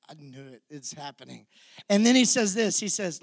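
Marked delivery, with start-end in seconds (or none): 0.1-1.5 hushed Peter. Retold or imitated. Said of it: "Peter's gotta be looking at John going".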